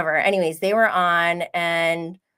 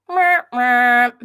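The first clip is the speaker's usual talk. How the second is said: kiss sounds; 4 semitones higher, 8 dB louder than usual. Real, not acted. Blowing kisses to the camera.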